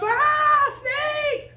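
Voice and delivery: high, loud scream of alarm; acted panicked yell; startled Moses. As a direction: shriek as if jumping back from a snake. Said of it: "And Moses goes".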